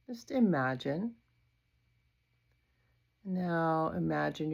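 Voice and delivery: unhurried yoga nidra voice with long pauses; guided meditation. Acted. Yoga teacher leading a body scan for sleep, guided meditation. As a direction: guide attention gently through the body, leaving space after each phrase.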